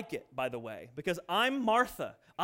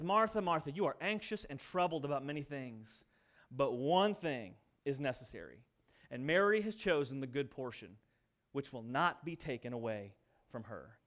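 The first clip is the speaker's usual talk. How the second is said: tender; 8 semitones lower, 5 dB quieter than usual. Acted. Jesus speaking tenderly to Martha.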